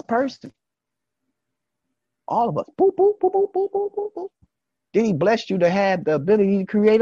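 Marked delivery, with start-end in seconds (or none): 2.8-4.3 squeaky high boops, one after another; playful high boops; high boop sound effect. Pretend. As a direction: pop them out like tiny bubbles. Mimics nations being made.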